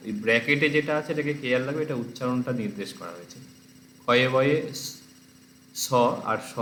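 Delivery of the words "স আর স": normal talking voice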